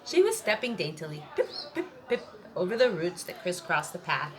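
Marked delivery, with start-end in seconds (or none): 1.4-2.2 dainty footsteps. A narrator's reading. Peanut stepping daintily.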